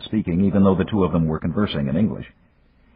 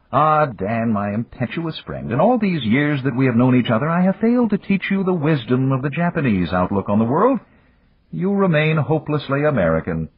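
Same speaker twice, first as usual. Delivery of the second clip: higher, measured proverb-giving voice; Yamagata; 9 semitones higher than usual. A narrator's reading. Yamagata offering Dan a proverb, higher and measured. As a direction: pass on ancient wisdom gently.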